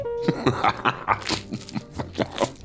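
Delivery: long, soft chuckle; drawn-out, breathy run of chuckles; amused laugh. Acted. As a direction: chuckle away quietly at the memory. Laughing at Silly being messy.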